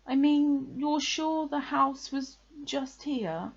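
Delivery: high, slow, careful question; anxious checking. A narrator's reading. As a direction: press the point with worried hope. Pooh anxiously checks again.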